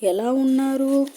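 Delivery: loud, high, happy hello to his sister; a delighted uncle's booming hello; cheerful greeting. A narrator's reading. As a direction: call out a bright welcome from the doorway. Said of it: "అని పలకరింపుగా వచ్చాడు".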